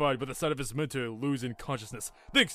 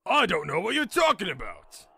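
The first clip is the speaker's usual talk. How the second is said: raised-pitch professor's protest; indignant retort; 5 semitones higher, 5 dB louder than usual. Acted. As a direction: throw the accusation back indignantly. Professor argues back, glaring.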